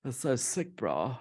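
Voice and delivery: demonstrating Cape Town talk, quietly; Cape Town accent. Shown as a demonstration. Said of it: "talk a little bit like this in Cape Town".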